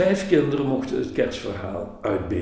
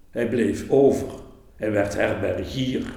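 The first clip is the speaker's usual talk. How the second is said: usual speaking voice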